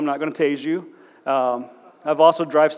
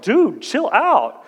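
slow, drawn-out, higher "dude, chill out"; mock pained plea; 9 semitones higher, much slower than usual. Cop retelling his plea after being slammed down, slow and drawn out. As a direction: stretch it out like you're hurting. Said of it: "I'm like".

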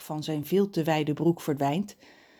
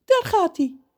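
story character John; 14 semitones higher, 7 dB louder than usual. A narrator's reading. John about to stick plaster on.

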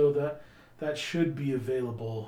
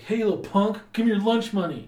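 higher, louder bully taunt; schoolyard bully; 9 semitones higher, 11 dB louder than usual. Acted. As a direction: shove the words at a smaller kid. Bully threatens Deke.

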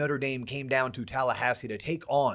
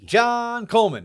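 grand entrance announcement; 7 semitones higher, 7 dB louder than usual. Acted. Introducing co-host announcer style.

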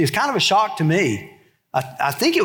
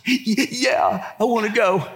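put-on tearful toddler; 5 semitones higher, slower than usual. Crying toddler answers grandma.

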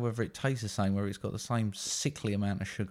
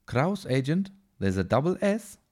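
put-on higher, pedantic German accent; Johann Kraus, German accent; 6 semitones higher than usual. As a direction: correct him primly in a German accent. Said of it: "Johann Kraus".

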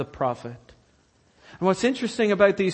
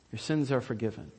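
hushed repeat; 6 semitones lower, 10 dB quieter, faster than usual. Acted. Quiet repeat of Jesus's words.

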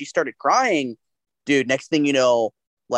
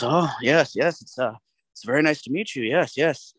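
Jeff Goldblum impression. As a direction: play it like a lightly voiced, quirky Goldblum. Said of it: "And he goes".

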